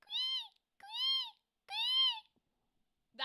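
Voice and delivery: long, very high "quee" squeals; chocobo squeak, E noise. Retold or imitated. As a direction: draw out each piercing bird squeak on ee. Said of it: "I gotta go the E noise".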